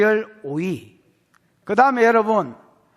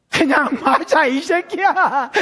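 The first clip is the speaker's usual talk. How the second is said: laughing; 7 semitones higher, 6 dB louder, slower than usual. Acted. Acted parent, laughing while preaching.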